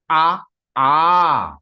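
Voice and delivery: A built up from long to extra-long "aaah"; extended plutaha vowel. Shown as a demonstration. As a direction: stretch each A further, ending on "aaah". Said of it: "It is extended three times".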